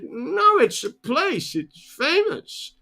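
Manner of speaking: high, insistent American tourist; mock American accent. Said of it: "That's my accent at the moment for the Americans".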